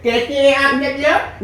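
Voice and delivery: softly drawn-out, mocking greeting; mocking intro imitation. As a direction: mimic the botched intro, slow and soft. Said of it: "नानी का intro और खराब करवा दे".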